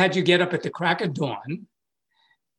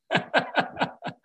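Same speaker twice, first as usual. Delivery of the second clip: incredulous high laugh; 11 semitones higher than usual. Real, not acted. Laugh at the dopey instruction.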